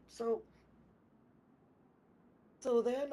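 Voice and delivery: ordinary talk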